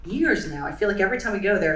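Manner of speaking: normal talking voice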